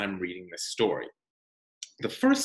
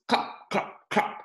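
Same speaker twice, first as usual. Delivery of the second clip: clop sound; 10 semitones higher, slower than usual. Acted. Horse sound in the story.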